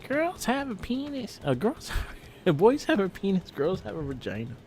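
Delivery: lightly imitating a little kid; quoting a kid. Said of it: "He's the one that said that line".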